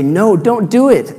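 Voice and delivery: high, loud, slow mock shout; imitated student yell. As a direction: cry out to stop the proposal. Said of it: "the students yell".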